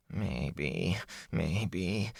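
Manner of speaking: very soft, drawn-out musing; hushed, thoughtful hedging; pensive. A narrator's reading. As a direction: mull it over under your breath. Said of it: "Fane rubbed his chin pensively".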